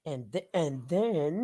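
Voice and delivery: very slow, soft mock echo; hushed, lingering imitation of a cliffhanger; drawn-out mimicry. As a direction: stretch out each word, teasing the narrator. Mimics the narrator's drawn-out then.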